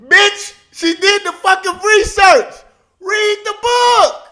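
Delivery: furious high shout at the screen; yelling. Real, not acted. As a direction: yell it out, disbelieving and outraged. Yelling at the clip.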